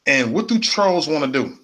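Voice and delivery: usual speaking voice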